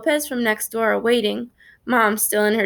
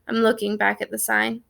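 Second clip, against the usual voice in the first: ordinary delivery, nothing marked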